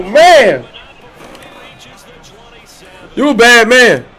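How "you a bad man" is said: ordinary delivery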